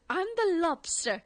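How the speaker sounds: put-on proud kid. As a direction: announce it like a proud little kid. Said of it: "that Love Actually, uh, quote".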